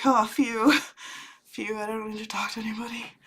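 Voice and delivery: fast whispered relief with a laugh; relieved laughing whisper. Retold or imitated. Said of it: "I'm like".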